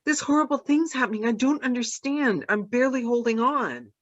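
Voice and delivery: high, fast anxious voice. Acted. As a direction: panicky and overwhelmed. Distressed voice acted.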